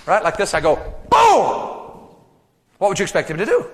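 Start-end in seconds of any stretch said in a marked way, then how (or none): 1.1-2.2 high sharp boom for the punch; cartoon punch noise; punch sound. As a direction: slam the impact out.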